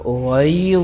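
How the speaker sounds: غیظ said very slowly, with a heavy, full غ; tajweed demo. Shown as a demonstration. Teacher shows students the heavy letter, slow and deliberate. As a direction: stretch غیظ out and make the غ thick. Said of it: "غا بھی موٹا ہے".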